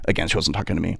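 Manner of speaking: ordinary delivery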